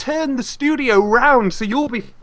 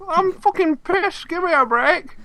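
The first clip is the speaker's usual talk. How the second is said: put-on British parody accent; 8 semitones higher than usual. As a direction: whine it in a put-on British voice, higher. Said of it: "all of our terrible British accents".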